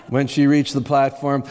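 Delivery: ordinary delivery, nothing marked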